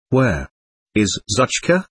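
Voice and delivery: halting, with a touch of a broken voice. A narrator's reading. Sick boy asks after his lost dog, mildly broken voice. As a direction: ask it haltingly, a little broken. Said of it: "Ilashaw asked in a broken voice".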